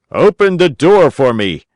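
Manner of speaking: calling through the door from the street; Geppetto shouting. A narrator's reading. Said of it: "Geppetto shouted from the street".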